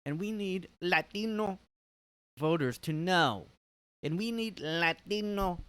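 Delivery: high, mock political-speech voice leaning on Spanish sounds; AOC impression. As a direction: lampoon her hitting the Spanish vowels hard. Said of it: "A O C does it bad".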